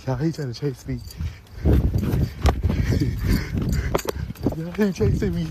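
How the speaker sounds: breathless laughing panic; panicked laughing. Real, not acted. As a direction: yell it while fleeing and laughing. Being chased, shrieking and laughing.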